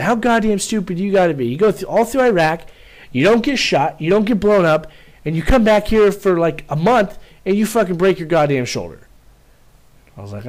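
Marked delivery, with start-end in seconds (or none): 0.0-9.0 imitating first sergeant. First sergeant ranting at the injured corporal, high mock anger. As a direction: rant like a fed-up sergeant, pitched up.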